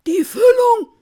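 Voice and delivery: high, loud complaint. A narrator's reading. Guest leaving after the feast, loud high complaint. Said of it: "sagt der eine laut".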